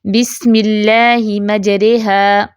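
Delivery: slow, مجریہا with the ra leaning toward e; slow Quranic recitation with imala; recited with imala. Shown as a demonstration. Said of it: "یہ جو مجرے کا رین یہ امالہ ہے".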